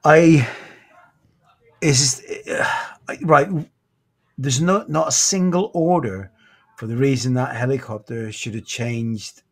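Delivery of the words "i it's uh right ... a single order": fighting back tears before answering; choking up. Real, not acted. Asked if he believes in fate, he chokes up. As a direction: voice catching with emotion, halting.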